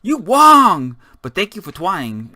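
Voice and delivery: sudden high, loud shout, then an easier thank-you; a professor yelling at a student at the board, hammed up; mock yelling professor. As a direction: bark the correction at the student, then let him off. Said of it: "he would just start yelling at you".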